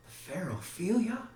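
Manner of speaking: high, rising disbelief; stunned question. Acted. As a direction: ask it in shocked realization, pitched high. Hamlet learns who is buried.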